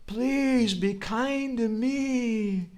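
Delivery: high, slow pleading wail; crying out. Retold or imitated. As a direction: cry out in longing. Voicing Narahari crying out.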